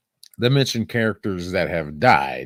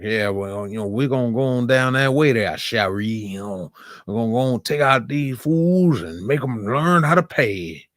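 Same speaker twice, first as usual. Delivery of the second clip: put-on easygoing Louisiana Creole accent, quick; light Creole accent. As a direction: breeze through it in a mild Creole accent. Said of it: "they had him speak in Creole".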